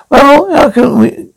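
ordinary talk

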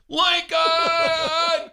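high, drawn-out scream; screamed name. Retold or imitated. Crowd as he got his diploma, re-enacted scream. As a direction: holler the name across an arena.

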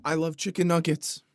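a hammed-up tearful confession; mock emotional declaration. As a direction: declare it like a hard-won truth. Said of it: "I'm gonna try to be strong".

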